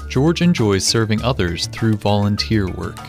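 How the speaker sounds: ordinary talk, nothing marked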